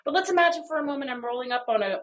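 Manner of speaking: ordinary talk, nothing marked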